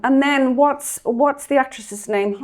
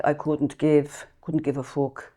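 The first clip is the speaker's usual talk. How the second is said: put-on Polish Dublin accent; 6 semitones lower, 6 dB quieter than usual. Quoting the beautician, imitated Polish Dublin accent. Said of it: "Polish Dublin".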